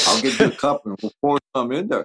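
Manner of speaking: light, teasing offer; hint of a sly grin; playful [name] voice. [name] offering spiked coffee.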